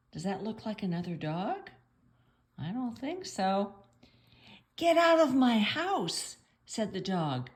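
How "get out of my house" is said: high, loud storybook order; mock angry dog. Dog ordering the man out of the doghouse, mock outrage. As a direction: pretend to throw someone out, raised voice.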